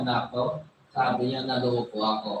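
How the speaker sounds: low, soft groan of being tricked; dismayed old father. Retold or imitated. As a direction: sink the voice low and quiet. Isaac realizes he was tricked.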